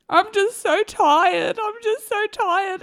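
slow, high, drained whimper; exhausted mid-labour plea. Retold or imitated. To the midwives in labour, slow worn-out whine. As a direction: draw each word out as if spent. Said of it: "I get to the point where I'm like".

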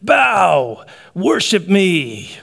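grand, drawn-out villain proclamation; Loki's command. Acted. Loki to defeated New York, grandly commanding. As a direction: lord it over the defeated, stretching each word. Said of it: "Loki ... looks over New York and he says".

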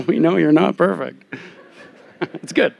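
teasing through real laughter; a chuckling jab from the crowd; laughing. Real, not acted. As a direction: laugh the words out as you rib him. Wife teases him, audience laughs.

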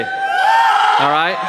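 cheer. Real, not acted. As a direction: whip the crowd up with a big shout. Cheering crowd for birthday.

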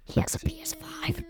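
hushed, voiceless whisper; whispered prompt. Real, not acted. Child prompts parent about new brothers' console, whispered. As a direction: whisper it as a quick aside.

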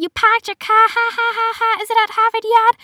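high, loud mock Boston jeering; mocking laughter. Kid mocking a stranger's accent in the hallway, laughing loudly. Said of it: "started dying laughing, and he said".

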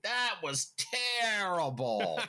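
slow, high, drawn-out verdict; Bill Walton impression. Mimicking Bill Walton's slow verdict on a missed shot. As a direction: stretch it out like an old broadcaster. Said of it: "he'll be like".

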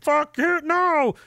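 high squeaky mock refusal; mock dismissal. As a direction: toss it off in a silly high voice. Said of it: "And they're like".

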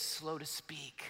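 hushed scripture quote; soft and slow. Real, not acted. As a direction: drop to a near hush. Quoting "slow to speak" softly.

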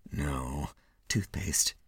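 ordinary delivery

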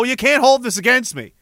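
normal talking voice

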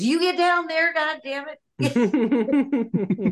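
high-pitched mock scolding mother; mother ordering. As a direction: imitate a mom cursing and ordering a child downstairs. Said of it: "my mother".

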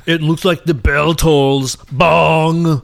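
slow Arnold-style kill line; hammy action-hero one-liner; Schwarzenegger quip. Pretend. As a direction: drag out the pun, landing hard on the last word. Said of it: "What if he said".

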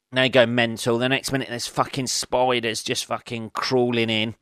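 ordinary talk

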